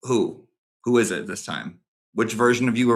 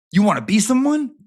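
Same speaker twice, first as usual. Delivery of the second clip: higher, louder mocking question; jeering question; 8 semitones higher, 4 dB louder than usual. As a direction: toss it up high as a sneering challenge. Mocking confrontation of Jimmy.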